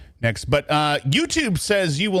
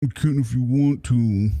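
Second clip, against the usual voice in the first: quoting her. Retold or imitated. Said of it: "She said".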